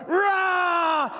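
high drawn-out roaring yell; lion roar shout. Acted. Preacher voicing the beast's roar at the sheep lion, long shout. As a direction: roar it like a lion across the river. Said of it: "the lion just keeps roaring".

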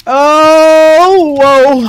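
startled loud oh whoa; jolted by sudden danger; surprised. Real, not acted.

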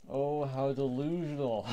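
slow, loud sneering voice ending in a laugh; mocking villain. A rival mocking Bowser's plan, slow sneering taunt. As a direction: sneer at him, then laugh.

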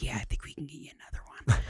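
fast hushed whisper; sweet conspiratorial whisper. Retold or imitated. Flight attendant secretly offering a third drink, fast whisper. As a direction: slip it to him like a secret. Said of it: "really sweet little Southern lady".

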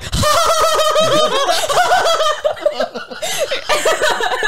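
high shrieking laugh; hysterical laughter. Real, not acted. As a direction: cackle out of control. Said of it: "hysterically laughing".

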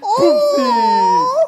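mock oopsie. Mock apology after a hit.

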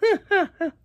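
short high real laugh; laughing at not having gloves. Real, not acted. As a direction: laugh it off, amused.

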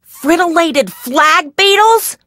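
stretched-out, disbelieving question, pitch climbing; can't believe the beetle news; incredulous. Real, not acted. Scientist hearing the beetle results, disbelieving.

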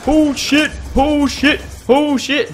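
loud high panicked cries; panicked shout. Real, not acted. Panicked as zombies swarm.

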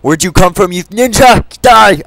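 loud high battle yell; yelling at mobs. Real, not acted. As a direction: shout them down mid-fight. Fighting mobs in Minecraft, yelling.

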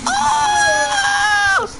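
very high scream; terrified scream. Real, not acted. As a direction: scream in sheer fright. Monster attacks.

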